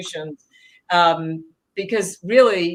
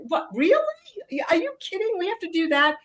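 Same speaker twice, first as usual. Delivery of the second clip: high, disbelieving squeak of surprise; mock outrage, pitched way up; incredulous; 11 semitones higher, faster than usual. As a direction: voice it like you can't believe the news. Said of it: "I'm like".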